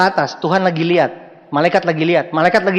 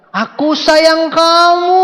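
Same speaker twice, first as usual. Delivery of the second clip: higher, louder, slow yell over traffic; shouted love line; 10 semitones higher, 4 dB louder, much slower than usual. Retold or imitated. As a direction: bellow it from the back of a motorbike. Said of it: "ngomong kencang-kencang".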